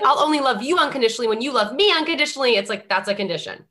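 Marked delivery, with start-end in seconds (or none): 0.0-2.6 mock demand. Mocking quote of conditional love.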